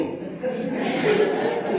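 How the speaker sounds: a real, breathy burst of high laughter. Real, not acted. Preacher laughs at the story.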